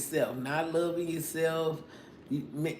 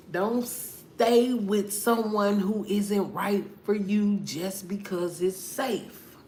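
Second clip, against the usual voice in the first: ordinary delivery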